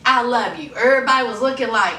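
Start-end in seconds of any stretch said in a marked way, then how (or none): none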